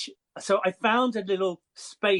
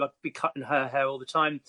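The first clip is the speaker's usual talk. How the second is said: ordinary delivery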